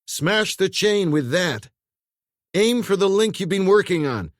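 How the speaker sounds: urgent command. A narrator's reading. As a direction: drive each word home. Trapped man commanding urgently.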